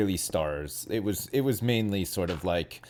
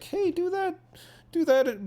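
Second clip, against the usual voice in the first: mom's voice; 13 semitones higher than usual. Retold or imitated. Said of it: "your mom is like".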